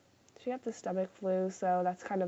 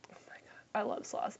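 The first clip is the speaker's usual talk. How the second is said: softer, whispered gush of love; adoring whisper; 9 dB quieter, faster than usual. Real, not acted. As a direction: whisper it like a secret crush. Gushing about loving sloths.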